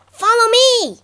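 high, loud call to follow; loud high-pitched mouse shout; urgent cry. A narrator's reading. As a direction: cry out to rally a frightened cousin. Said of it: "cried his cousin".

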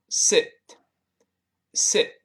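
"sit" with a short clipped i, whispered. Shown as a demonstration. Teacher contrasts the verb "sit" with "seat", whispered short i. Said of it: "坐下动词读成sit".

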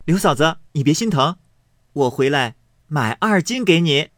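teasing loudly while breaking the cakes; laughing taunt. A narrator's reading. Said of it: "口内笑说". (笑着大声打趣)

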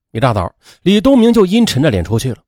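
ordinary delivery

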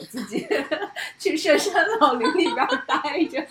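high, loud, laughing through it. Real, not acted. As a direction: burst out laughing mid-sentence. Joking about retreating, laughing.

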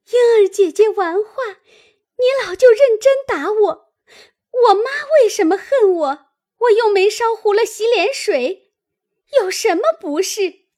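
loud, high-pitched wailing complaint; tearful protest. A narrator's reading. Beaten maid protests to her aunt, crying out high. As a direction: wail out the injustice, high and hurt. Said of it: "哭道".

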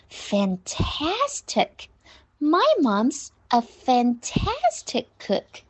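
"fantastic" slowed, TAS stretched long; slow model line, big drawn-out TAS in "fantastic"; exaggerated TAS. Shown as a demonstration. Teacher showing pupils the key syllable, exaggerated TAS. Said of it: "把tas发得很夸张".

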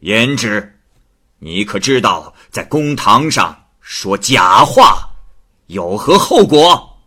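loud, slow, enraged magistrate; furious. Acted. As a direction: roar at her for lying in court. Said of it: "大怒道". (太守大怒，厉声质问胭脂)

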